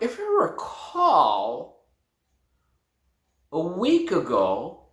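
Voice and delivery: "If you recall" slow, rising on you. Shown as a demonstration. Teacher to students, slow rising recall. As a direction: draw out the phrase. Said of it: "I would say something like".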